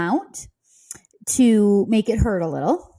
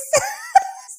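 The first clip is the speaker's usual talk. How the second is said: shrieking laugh. Real, not acted. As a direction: cackle with triumph. Winning the deal.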